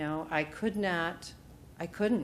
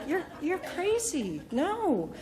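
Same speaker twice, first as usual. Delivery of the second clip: high, slow you're crazy; pitched-up mock disbelief; incredulous no; 10 semitones higher, slower than usual. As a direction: scoff at a crazy idea. Refusing Meg's idea.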